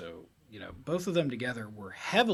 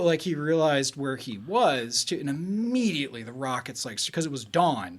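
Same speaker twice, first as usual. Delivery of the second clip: normal talking voice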